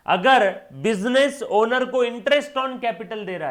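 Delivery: loud, high, slow announcement; drawn-out high classroom shout; emphatic proclamation. Real, not acted. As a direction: call it out slowly at full voice. Teacher announcing next item emphatically.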